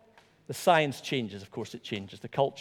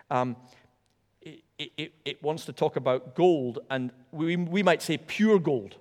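ordinary talk, nothing marked

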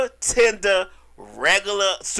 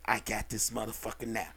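much lower and softer, smug; sly low masseur; 11 semitones lower, 14 dB quieter than usual. Retold or imitated. Masseur thinking to himself, low and smug. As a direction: murmur it like a predator who's sure.

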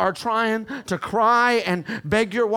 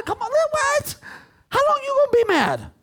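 high-pitched challenge. Real, not acted. Preacher challenging those who stay mad, high-pitched exclamation. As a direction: voice leaping very high.

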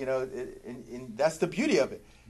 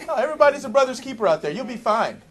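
fast, high, loud mock reassurance; sarcastic; 10 semitones higher, 7 dB louder, faster than usual. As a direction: brush off Cain's fear with laughing sarcasm. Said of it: "That's my sarcasm".

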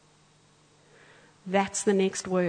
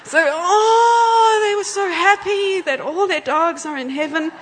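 much higher, louder crying shout of joy; joyful cry; 9 semitones higher, 9 dB louder than usual. Real, not acted. Preacher overcome telling how the crowd rejoiced.

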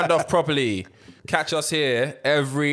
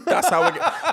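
laughing; 12 semitones higher, slower than usual. Real, not acted. Laughing at identity joke.

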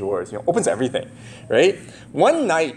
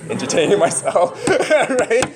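laughing; 8 semitones higher than usual. Real, not acted. Laughing at daughter.

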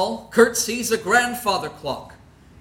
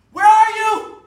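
loud, very high frantic call; desperate shout; 12 semitones higher, 8 dB louder than usual. Acted. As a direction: yell into the empty house. Kurt shouts for Penny.

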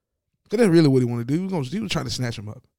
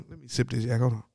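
ordinary delivery

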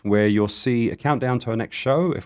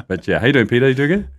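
ordinary talk

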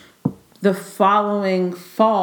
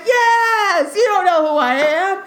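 high, drawn-out mock wail; hammed-up exasperated yell; exasperated retort; 11 semitones higher, slower than usual. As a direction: cry it out in comic indignation. Retold outburst at forgetful date.

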